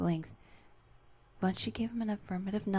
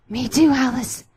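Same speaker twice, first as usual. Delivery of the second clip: loud, higher strained voice; strained reply; 7 semitones higher, 14 dB louder than usual. Acted. Bendy answering Alice, loud and strained.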